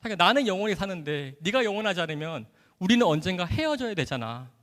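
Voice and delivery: ordinary talk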